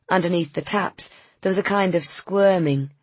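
ordinary delivery, nothing marked

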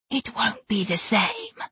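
whispered promise. Acted. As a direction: whisper it close in his ear. She promised.

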